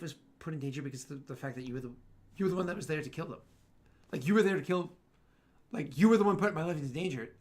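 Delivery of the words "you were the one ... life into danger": heated argument. Acted. GM voices Solarian in argument.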